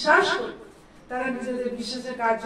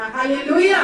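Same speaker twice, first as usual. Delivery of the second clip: called hallelujah; 7 dB louder than usual. Real, not acted. Preacher calls to congregation.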